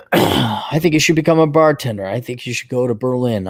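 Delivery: ordinary talk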